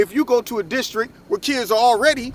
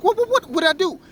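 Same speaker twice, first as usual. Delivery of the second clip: imitated shocked protest; 9 semitones higher than usual. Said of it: "He was like".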